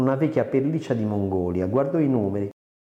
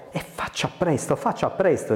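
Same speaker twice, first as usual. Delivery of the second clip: higher-pitched urgent pleading; imploring; 7 semitones higher than usual. A narrator's reading. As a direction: beg him to hurry, voice lifted. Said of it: "implorava Carina".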